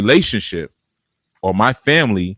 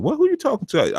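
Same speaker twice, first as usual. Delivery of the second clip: much higher, offended mother's retort; indignant mother; 3 dB quieter than usual. Retold or imitated. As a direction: mom bristling at being confronted. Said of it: "cut through all that and say".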